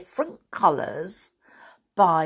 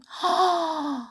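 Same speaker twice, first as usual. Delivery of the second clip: excited gasp; 10 semitones higher than usual. Pretend. Gasp over Science Museum.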